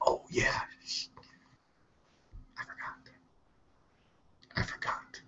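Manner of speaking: breathy whispered aside. Retold or imitated. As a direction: whisper it, caught out. Acting out his own forgetful reply.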